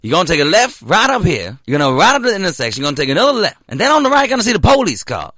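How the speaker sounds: lively Southern guard giving directions; southern accent. Retold or imitated. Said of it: "The southern one".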